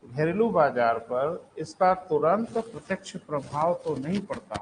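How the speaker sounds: spaced out so students can write. Real, not acted. Repeats the sentence for writing.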